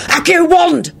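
very high, urgent spell shout; high-pitched urgent incantation. A narrator's reading. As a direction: shout to summon it at once. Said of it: "he shouted".

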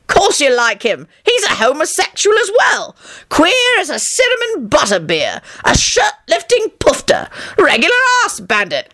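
a hint of a jovial old wizard, pleased with himself; John, boastful old man. A narrator's reading. John teasing.

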